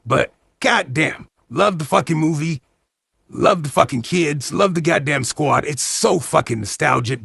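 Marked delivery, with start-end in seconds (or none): none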